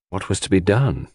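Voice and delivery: ordinary delivery, nothing marked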